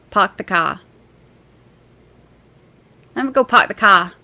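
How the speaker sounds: Boston accent, R's dropped from "park" and "car". Shown as a demonstration. Streamer practising for a Boston viewer, Boston accent. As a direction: try it like a Bostonian. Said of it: "Brandy, can you say".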